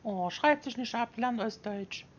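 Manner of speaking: high, hammed-up Saxon dialect; Saxon accent. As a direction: put on a thick Saxon accent, teasing. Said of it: "Kannst du kein Sächsisch?".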